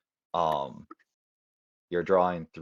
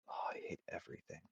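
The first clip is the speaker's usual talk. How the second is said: near-whispered grumble to yourself; quiet despair; 13 dB quieter than usual. Real, not acted. Player agonizing over a move, whispered despair. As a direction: say it under your breath, voiceless.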